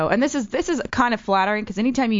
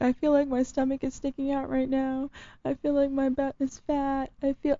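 mock tearful girl; 6 semitones higher, 5 dB quieter than usual. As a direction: imitate a girl whimpering about her body. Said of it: "she starts crying".

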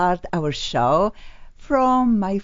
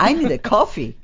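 laughing; 10 semitones higher, 5 dB louder than usual. Retold or imitated. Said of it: "And I'm like".

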